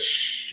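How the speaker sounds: soft breathy shush; quick unvoiced hushing sound. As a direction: hush someone like a worried girlfriend. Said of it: "and she says".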